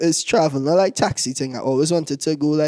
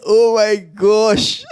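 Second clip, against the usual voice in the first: higher, very slow, drawn-out gushing; mock swooning girl; 5 semitones higher, 3 dB louder, much slower than usual. Girl impressed by his car, mock gushing. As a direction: imitate a girl overcome with admiration.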